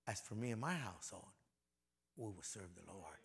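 a quiet personal promise; soft, hushed vow. Shown as a demonstration. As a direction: say it almost to yourself. Repeating Joshua's vow softly.

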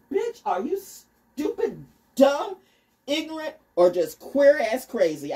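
mocking insult. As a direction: sneer at them slowly. Mock insulting critics.

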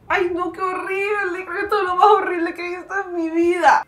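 real upset, close to tears; tearful dismay. Real, not acted. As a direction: let the voice break in horror. Upset by the rabbit video.

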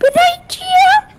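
Gio squealed high at the end; squeaky rising threat. Pretend. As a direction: pitch jumps way up on Gio. Repeats threat, voice jumps up.

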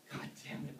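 breathless whisper of despair; whispered curse. Acted. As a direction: whisper it under your breath. Breaking down asking for help.

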